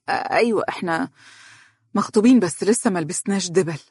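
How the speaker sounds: halting, mildly nervous admission; understated nervous confession with broken starts; increasingly tense. A narrator's reading. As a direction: hesitate after clearing the throat, uneasy. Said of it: "تنحنحت وقد زاد توترها وهي تقول".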